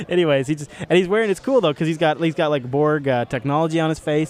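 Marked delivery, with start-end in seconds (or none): none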